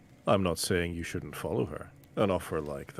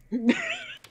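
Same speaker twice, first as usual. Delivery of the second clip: sudden high-pitched giggle; high trickster cackle. Acted. Trickster teasing player, high mischievous giggle. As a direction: let a wicked giggle escape.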